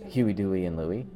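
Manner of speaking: normal talking voice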